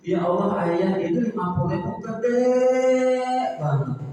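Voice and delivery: a wife overjoyed at fifty thousand; delighted wife. Acted. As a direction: sound thrilled with the money. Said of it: "kata istrinya".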